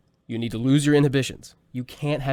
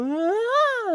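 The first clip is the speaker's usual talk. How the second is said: vocal warm-up slide. Shown as a demonstration. Warming up the voice, teacher demos a sweep up to very high. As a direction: sweep up from the bottom to the very top. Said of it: "go all the way down and then go".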